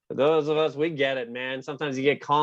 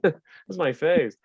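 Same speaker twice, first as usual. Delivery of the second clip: ordinary talk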